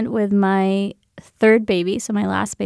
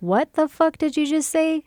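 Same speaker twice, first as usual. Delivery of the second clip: higher-pitched, affronted comeback; retold indignant challenge; 6 semitones higher than usual. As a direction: replay an offended demand without shouting. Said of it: "I'm hormonal and pregnant".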